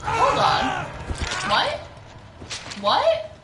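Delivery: high-pitched, rising disbelief; baffled surprise. Real, not acted. Player baffled at having downed someone, high surprised questions. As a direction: jump up high in real confusion.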